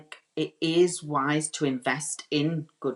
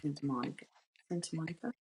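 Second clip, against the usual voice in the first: hushed warm-up in an American accent; attempted American accent; 12 dB quieter, faster than usual. Shown as a demonstration. Stylist finding the accent before answering, tentative American accent. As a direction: softly test out an American accent. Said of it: "in your best American accent".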